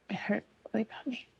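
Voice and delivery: breaking into a hushed, choked whisper; barely voiced, holding back tears; tearful whisper. Real, not acted. Telling the camera about church hurt, tearful whisper. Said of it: "I'm getting emotional".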